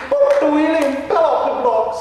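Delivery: hammed-up English gentleman ghost; mock English accent. Ghost Nigel answers the realtor in a fake English accent. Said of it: "a Texan trying to emulate an Englishman".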